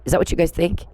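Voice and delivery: normal talking voice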